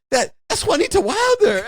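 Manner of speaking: very high, gushing mock accent; excited gangster. Thug boasting to his men, high and gushing. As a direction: squeal the name like a fanboy.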